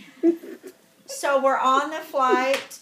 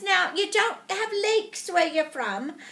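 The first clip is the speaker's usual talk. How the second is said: put-on English accent; 5 semitones higher than usual. English lady asking about leeks, imitated English accent. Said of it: "from England".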